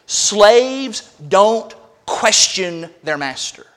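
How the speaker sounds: slow, each word set apart; very emphatic. Real, not acted. Preacher telling his congregation a hard truth, very emphatically. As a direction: drive it home word by word, stretched out. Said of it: "I want to say very emphatically".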